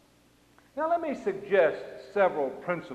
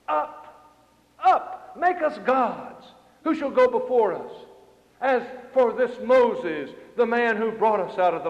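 raised, loud call to a leader; acted clamor, pitched up; crowd's demand; 4 semitones higher, 5 dB louder than usual. Preacher voices Israelites demanding gods.

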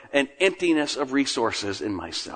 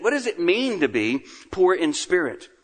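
usual speaking voice

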